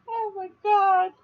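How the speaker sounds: high, slow, breathless laughing; laughing to tears. Real, not acted. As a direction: gasp it out between laughs.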